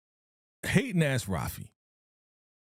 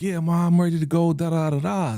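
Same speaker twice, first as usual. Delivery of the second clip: put-on higher, louder eager kid voice; 6 semitones higher, 4 dB louder than usual. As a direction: play the excited son, ready to go. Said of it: "he's like".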